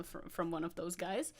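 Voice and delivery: normal talking voice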